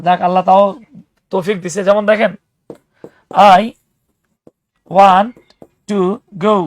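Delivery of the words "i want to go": "I want to go" with pauses between words. Shown as a demonstration. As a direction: say each word apart, no linking. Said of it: "এটা কি হয়ে যায়?".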